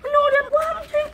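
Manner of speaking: usual speaking voice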